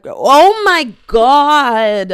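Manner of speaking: loud, drawn-out oh my God; exasperated outburst. Real, not acted. Host tripping over caricature, loud and slow. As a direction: let the frustration burst out slowly. Flustered at stumbling on a word.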